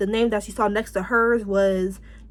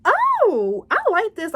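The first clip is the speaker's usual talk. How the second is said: excited gasp; 11 semitones higher, 4 dB louder, slower than usual. Retold or imitated. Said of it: "And I'm like".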